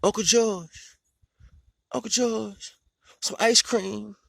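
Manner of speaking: put-on high childish pleading voice; high kid voice asking for ice cream; little nephew voice. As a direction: beg like a small kid. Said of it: "My nephew was like".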